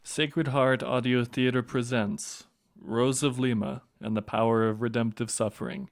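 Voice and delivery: usual speaking voice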